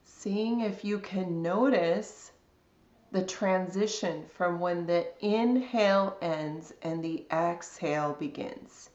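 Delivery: calm, unhurried body scan guidance with long pauses; guided meditation. Real, not acted.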